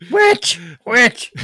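loud high cry of Witch; high-pitched shouting. Acted. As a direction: yell it like an old woman. Acted movie scene.

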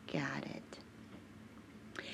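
soft whisper to herself. Real, not acted. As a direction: barely breathe it. Whispered aside.

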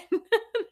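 sudden high-pitched giggle; high squealing laugh. Real, not acted. Laughing at her own small victory, high squeal. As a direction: burst into a squeaky laugh at yourself.

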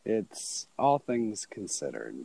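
low, soft public-radio host; NPR voice. Acted. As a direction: intone it like an NPR show ident, hushed. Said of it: "[name]'s using his N P R voice".

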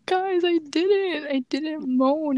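high, drawn-out protest; imitated exasperated plea, slowly; retold pleading denial. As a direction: stretch it out, begging to be believed. Retold repeated denial to classmates.

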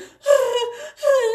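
put-on a sudden high yelp of a hurt child crying; brief high cry, loud and strained; imitated crying wail. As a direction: mimic her son's pained cry, pitched very high. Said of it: "but he went".

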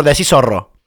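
"zorro" con S inicial, a la argentina; pronunciación con seseo. Shown as a demonstration. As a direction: di "zorro" empezando con sonido de S. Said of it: "es un zorro y es con Z". (seseo argentino)

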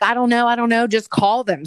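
loud retold outburst from a flustered mom; exasperated mom. Mom fending off her yelling husband, loud and exasperated. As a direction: raise the voice, brushing someone off. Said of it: "And Mom's like".

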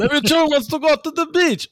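high, loud put-on foreign accent; voicing the baby in some foreign accent, way up high; odd European-sounding accent, pitched high and loud; vague foreign accent. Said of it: "Are you Portu-, Portuguese or Italian?".

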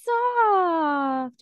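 high, slow, sweet mock coo; cooing heroine. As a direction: coo it like a smitten girl. She coos over his soft tail.

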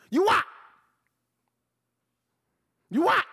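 loud high yell; yelling the coach is fired; shout. Real, not acted. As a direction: bark him out the door.

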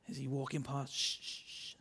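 whispered shushing, hiding; hushing whisper. Retold or imitated. Adam and Eve hiding in the bushes, whispered shush. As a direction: shush them while hiding. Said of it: "stood in the bushes going".

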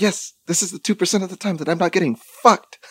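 high-pitched, celebratory cry; retold jubilation, voice pitched well up; triumphant. Celebrating the rare two percent, high triumphant voice.

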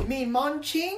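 high, soft mock British voice; squeaky take on British accent. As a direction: pipe it up in fake British. Mimicking the Manchester accent back.

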